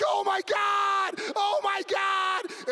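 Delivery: high, loud, drawn-out screams; mock terrified shout. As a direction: scream it slowly like hurtling kids. Kids hurtling downhill.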